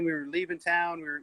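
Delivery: ordinary talk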